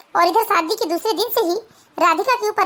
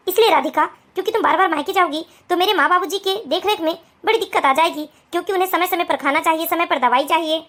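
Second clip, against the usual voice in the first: lower, calm husband voice; lowered male voice, steady; 3 semitones lower than usual. Acted. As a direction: explain patiently to your new wife. Husband explains the rule.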